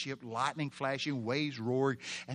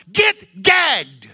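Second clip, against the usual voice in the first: high, explosive two-word shout; screamed rebuke; 13 semitones higher, 17 dB louder than usual. Acted. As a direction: roar it to silence the storm. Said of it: "He said a Greek word".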